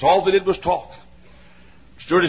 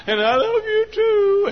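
very high, slow falsetto reply; mock lovestruck woman; 12 semitones higher, slower than usual. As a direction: imitate a besotted bride in a high coo. Mimics honeymooner, woman's part.

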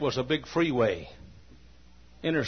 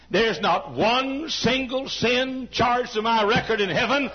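higher, louder, slowed preaching; fervent preaching; 9 semitones higher, 5 dB louder than usual. Acted. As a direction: press each word with conviction.

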